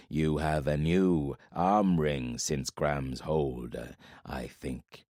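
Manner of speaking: low, very slow, heavy voice of a giant warlord; menacing warlord from the shadows. Acted. Giant warlord speaks to Corbin from the shadows, low and slow. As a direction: let each word drop slow and low like a giant's threat.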